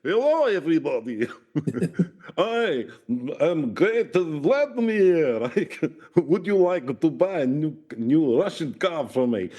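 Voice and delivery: high, drawn-out Russian car salesman; comic Russian accent. Acted. Russian newcomer pitches cars to locals, comic Russian accent. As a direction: greet them in a hammed-up Russian accent. Acting Putin in EastEnders.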